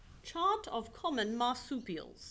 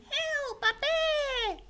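high, shrieking cry for help; mock bushwalker scream; 4 dB louder, slower than usual. Scared bushwalker screaming at the koala, very high. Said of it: "makes bushwalkers scream".

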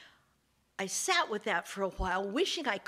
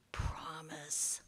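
low, hushed whispered promise; sly whisper; 7 semitones lower, 10 dB quieter than usual. Acted. Sly snake makes false promise.

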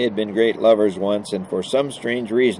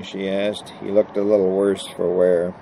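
ordinary delivery, nothing marked

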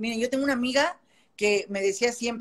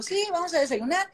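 put-on Jarocho accent; 8 semitones higher, 4 dB quieter than usual. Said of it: "ya hablamos jarochos los dos".

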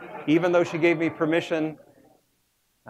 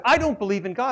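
put-on higher, louder child voice; a little girl stating it proudly; daughter's declaration; 9 semitones higher, 4 dB louder than usual. Imitating his daughter telling dad, raised child voice. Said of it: "and then she said".

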